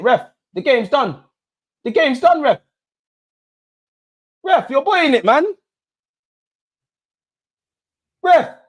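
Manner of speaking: desperate fan yelling at the referee; exasperated pleading. Real, not acted. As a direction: plead with the ref, voice climbing. Pleading with referee late in game.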